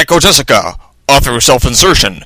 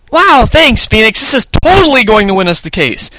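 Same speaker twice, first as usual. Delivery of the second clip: high-pitched, gushing thanks; excited Maya; 8 semitones higher than usual. A narrator's reading. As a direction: squeal with hope that the case is won. Maya thanking her lawyer.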